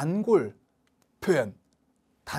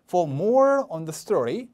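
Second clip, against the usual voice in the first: "more" punched, rising at the comma; slow, lean hard on "more"; stress on more; much slower than usual. Shown as a demonstration. Teacher showing stress on the comparative, punching "more". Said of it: "more, 비교급".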